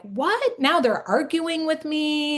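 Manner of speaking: high, incredulous mock outrage; exasperated inner mom. Mother of a tween, stunned by her arguing back. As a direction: voice the mom's shocked disbelief.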